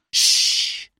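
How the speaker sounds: sharp unvoiced shush; long hissed "shh" for silence; hushing shh. A narrator's reading. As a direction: shush your companion. Robin hushes Little John.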